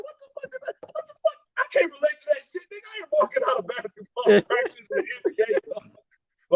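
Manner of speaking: high, squeaky, laughing mock outrage; incredulous rant. Fan mocking an NBA rapper, shrill laughing outrage. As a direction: squeal it in disbelief. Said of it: "I'm like".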